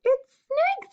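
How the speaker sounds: high, loud mouse voice; high-pitched storybook mouse; cheery mouse. Acted. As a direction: announce it boldly in a high little voice. Mouse announces snake.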